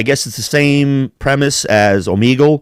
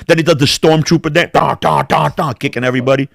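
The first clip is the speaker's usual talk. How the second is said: ordinary delivery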